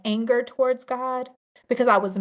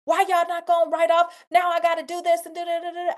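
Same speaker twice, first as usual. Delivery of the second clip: higher, fast mock complaint, grumbling; mock angry rant; 7 semitones higher, faster than usual. To the audience, imitating the angry self she should be, mock grumble. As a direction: play the annoyed grumbler, half-joking. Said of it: "like, mad and angry".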